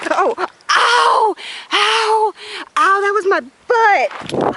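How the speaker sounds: high loud yelps of pain. Real, not acted. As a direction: cry out from a fall. Fell from tree.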